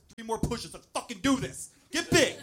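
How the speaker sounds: high, loud coaching shouts, building; gym spotter hype. Retold or imitated. Husband coaches wife pushing, gym spotter hype. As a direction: pump them up, climbing to a shout. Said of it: "like, spotting her at the gym".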